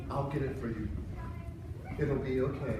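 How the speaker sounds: put-on calm him down gently like a child; a touch of a wife soothing her sulking husband. Wife comforts him, rubs head.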